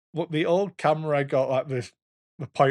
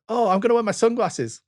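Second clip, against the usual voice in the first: put-on a child's excited line; 9 semitones higher, 3 dB louder than usual. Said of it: "It's like".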